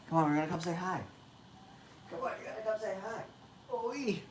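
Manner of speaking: distant, very faint call to a dog; far-off voice, barely picked up; away from the mic. Real, not acted. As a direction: coax the pet from across the room.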